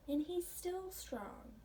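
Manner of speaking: ordinary delivery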